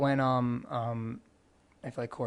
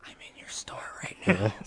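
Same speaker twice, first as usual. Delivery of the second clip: creepy caller's hushed whisper; 4 semitones lower, 3 dB quieter than usual. Pretend. Creepy caller tells the store worker they are inside, whispered. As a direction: whisper it like a creepy phone call. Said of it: "That would be the creepiest call ever".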